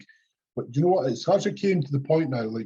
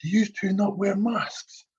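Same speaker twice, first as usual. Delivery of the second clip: much higher, slow, snooty shop lady; mocking shop lady; 9 semitones higher, slower than usual. Mimicking the shop lady questioning masks, high slow snoot. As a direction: pitch her up and drag it out, mock. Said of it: "and she goes".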